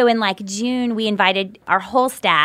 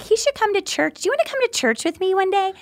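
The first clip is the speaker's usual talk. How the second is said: eager invite; 10 semitones higher, 3 dB quieter, faster than usual. Retold or imitated.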